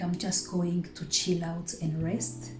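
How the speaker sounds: ordinary talk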